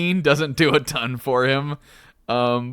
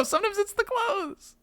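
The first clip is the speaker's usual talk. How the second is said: jokey falsetto aside; high mock voice; 5 dB quieter than usual. Joking to his friend in a squeaky high voice.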